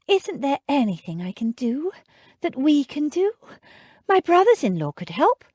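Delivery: desperate lady; pleading. A narrator's reading. Jane pleading.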